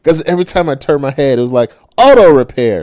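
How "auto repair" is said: mocking sign. Sign mocking him each glance.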